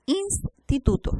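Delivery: Instituto slow, INS held apart; first syllable separated. Shown as a demonstration. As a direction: say INS, pause, then TITUTO. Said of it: "Vocal, consonante".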